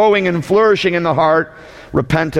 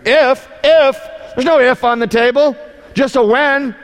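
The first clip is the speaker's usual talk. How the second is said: indignant shout; 9 semitones higher than usual. Real, not acted. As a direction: blast the word if away. Preacher rejecting if-apologies.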